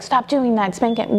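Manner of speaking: ordinary talk, nothing marked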